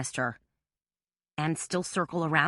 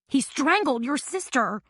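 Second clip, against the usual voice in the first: screaming; 9 semitones higher than usual. A narrator's reading. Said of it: "Carmela screamed".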